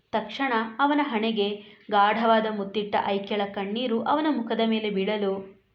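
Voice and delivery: ordinary delivery, nothing marked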